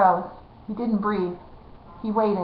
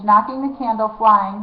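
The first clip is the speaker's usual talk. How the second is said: ordinary delivery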